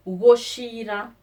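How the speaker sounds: goshira said loud, go-shee-ra; Kikuyu word. Shown as a demonstration. Teacher stresses goshira for the class, loud. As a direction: call out goshira clearly. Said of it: "To discuss is called".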